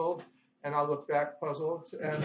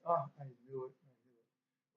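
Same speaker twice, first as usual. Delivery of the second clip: low, very soft, fading away; acted shaken voice; 4 semitones lower, 10 dB quieter than usual. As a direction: shrink to a near-inaudible murmur. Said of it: "now Homer's really shaken".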